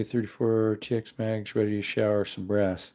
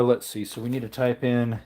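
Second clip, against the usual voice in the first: ordinary talk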